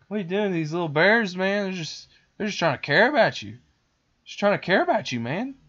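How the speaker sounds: high, loud incredulous protest; mock exasperated. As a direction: plead with him to lay off, half-joking. Teller protesting at the boy.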